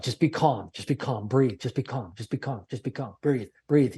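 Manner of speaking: soft, hushed self-talk to steady the nerves; calming inner voice. Retold or imitated. Facing the executive who hired him, a soft calming inner voice.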